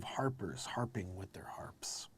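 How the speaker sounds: whispered scripture line; breathy hushed reading. Acted. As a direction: drop to a hush for the harpers. Reading about harpers.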